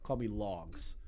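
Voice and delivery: low, soft, smug voice; lightly voiced self-important YouTuber; a hint of a snooty celebrity; mock Logan. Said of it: "And he's like".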